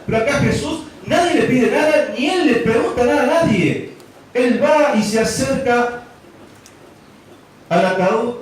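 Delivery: a preacher stressing that nobody asked Jesus; emphatic preaching. Acted. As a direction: drive the point home with fervour. Climax of sermon point.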